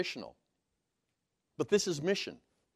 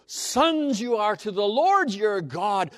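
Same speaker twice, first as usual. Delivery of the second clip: "Sons" stressed first, read slowly and louder; emphatic reading; 4 semitones higher, 6 dB louder, slower than usual. Shown as a demonstration. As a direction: open by punching "Sons", then slow down. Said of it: "it's emphatic".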